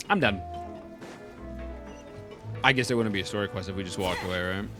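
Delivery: ordinary talk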